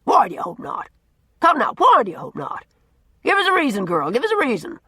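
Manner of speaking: put-on loud, gruff, teasing old man holding forth; blustering old Norman. A narrator's reading. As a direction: boom it out like a cranky, likeable bully. Norman presses her.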